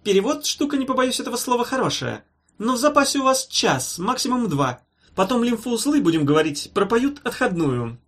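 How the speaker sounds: high, mannered doctor's verdict; pompous professor. A narrator's reading. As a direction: deliver doom in fussy patter. Professor pompously pronounces doom.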